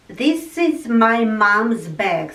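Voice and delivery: usual speaking voice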